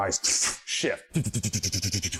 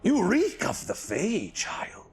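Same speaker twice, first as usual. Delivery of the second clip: hissed eerie whisper; whispering bird spirit; 10 semitones higher, slower than usual. Acted. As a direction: hiss it with menace.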